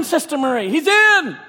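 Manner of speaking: ordinary delivery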